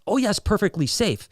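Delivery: high, breezy mock-calm; ironic reassurance. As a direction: toss it off lightly. Movie character's ironic reassurance.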